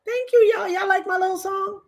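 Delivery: playful high thanks. Pretend. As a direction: fish for praise playfully. After singing.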